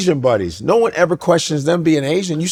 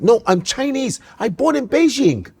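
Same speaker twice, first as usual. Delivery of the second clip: put-on Chinese accent; 7 semitones higher than usual. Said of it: "and I had the accent".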